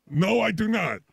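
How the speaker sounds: ordinary talk, nothing marked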